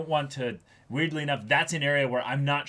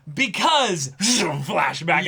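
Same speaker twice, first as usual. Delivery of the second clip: slow, drawn-out sarcastic punchline with a laugh; deadpan mockery; dragged-out sarcastic flashback; sarcastic answer; 5 semitones higher, 6 dB louder, much slower than usual.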